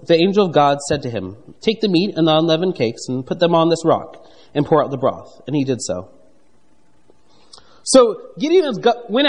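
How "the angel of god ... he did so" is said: lower, measured Bible reading voice; scripture reading. Acted. Preacher reads Gideon's exchange from Judges, measured scripture reading. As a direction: read the verses aloud to the congregation.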